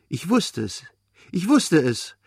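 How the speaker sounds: put-on high, excited child's outburst; blurted out. A narrator's reading. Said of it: "stieß er seinen Lieblingssatz hervor". (hoch und aufgeregt hervorgestoßen)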